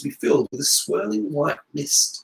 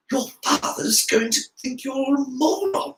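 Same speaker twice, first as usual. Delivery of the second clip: hiss; 10 semitones higher than usual. A narrator's reading. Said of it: "hissed Ha-, Harry".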